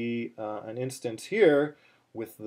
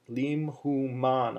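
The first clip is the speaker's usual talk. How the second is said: slow "lim hu man", syllables apart, landing on "man"; "man" drawn out and falling; stress on final syllable; slower than usual. Shown as a demonstration. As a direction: separate each syllable and weight "man". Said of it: "on the third and final syllable".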